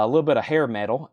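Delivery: ordinary talk, nothing marked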